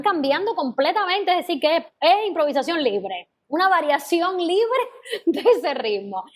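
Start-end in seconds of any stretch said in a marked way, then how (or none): 3.5-6.2 higher, slow, breaking into a laugh; teasing chuckle in the words; amused laughing. Real, not acted. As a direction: laugh midway through the phrase. Teacher laughs at students' free rhythm.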